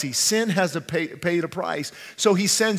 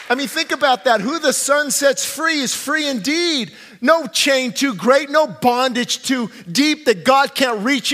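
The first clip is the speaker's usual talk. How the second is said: loud, raised-pitch sermon climax; impassioned preaching; 6 semitones higher, 5 dB louder than usual. Acted. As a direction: preach it with mounting force. Preaches at full force.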